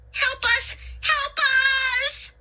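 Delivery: very high panicked shrieks; frantic high-pitched wailing; desperate cry for help. A narrator's reading. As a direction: shriek for rescue, stretching the last cry. Trapped children cry for help.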